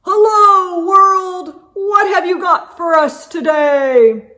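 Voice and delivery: booming high greeting to the world; real loud voice. Acted. Poppy greeting the morning from the window, loud and cheerful. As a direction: call out big and cheerful. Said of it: "says in a real loud voice".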